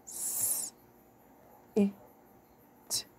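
S, I, T sounded one at a time; sounding out words. Shown as a demonstration. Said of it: "Let's go".

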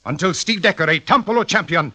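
ordinary talk, nothing marked

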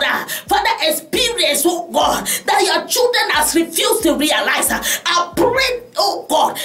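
prayer building to fervent shouted pleas; pastor's impassioned prayer; intercessory prayer. Acted. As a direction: cry out to God with rising intensity. Leads congregation in prayer.

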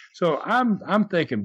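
usual speaking voice

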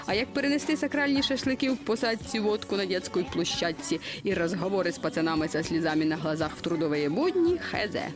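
ordinary delivery, nothing marked

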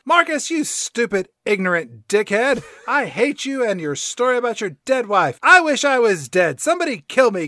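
loud, hammed-up angry rant; mock-furious tirade; Grumpy Cat. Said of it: "And then Grumpy Cat says".

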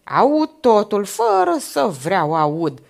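loud, slow, emphatic insistence; heated. A narrator's reading. Old man carries on before the major, heated. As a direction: drag out each word forcefully, well above normal volume. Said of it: "urmă înfierbântat bătrânul".

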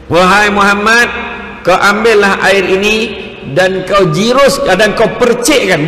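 lightly voiced solemn herald; lightly acted angel. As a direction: deliver instructions loudly and slowly, like a heavenly messenger. Voices Jibril instructing the Prophet.

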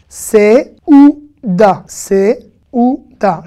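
C'est ou da said very slowly, syllable by syllable. Shown as a demonstration. Teacher slowly modeling seouda syllable by syllable. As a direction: stretch C'est ou da out with a clear E on the first syllable. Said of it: "je peux pas dire s ou da".

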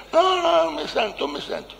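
mock-shrieked refusal in an imitated woman's voice; woman refusing. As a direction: squeal the refusal high, then fade out.

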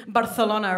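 "Barcelona" as Bar-the-LO-na; Spanish pronunciation. Shown as a demonstration. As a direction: show off "Barcelona" with a Castilian lisp. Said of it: "I pronounce words in their original accents".

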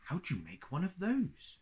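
high, curious question. A narrator's reading. Pooh asks Christopher Robin how it is made, curious. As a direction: lift the pitch with open wonder. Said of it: "Pooh asked curiously".

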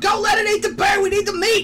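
urgent shout. Real, not acted. Protecting the bear.

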